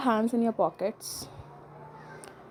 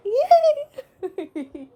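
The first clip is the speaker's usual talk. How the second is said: high squealed yay with a laugh; mock cheer; 13 semitones higher than usual. Celebrating an accounting joke, laughing squeal. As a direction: squeal it like a silly win.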